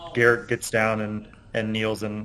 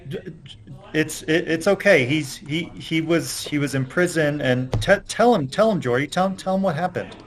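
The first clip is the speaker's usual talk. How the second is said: higher, stammering, urging; anxious young man; 6 semitones higher than usual. Acted. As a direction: push the boy to explain, flustered. Garrett urging the boy.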